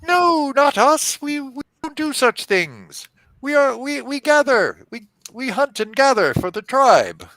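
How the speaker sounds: high, pleading fey voice; small fey creature. Acted. Captured fey pleads innocence to the knight, high and aggrieved.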